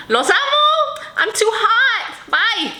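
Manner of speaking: high, loud, excited goodbye shout; exuberant sign-off. Real, not acted. Host cheering goodbye to viewers, very excited. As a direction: burst out with a giddy farewell. Signing off the video with gusto.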